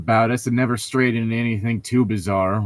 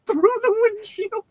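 laughing. Real, not acted. Joking about leaping through windshield.

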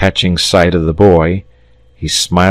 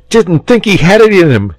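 plain reading voice

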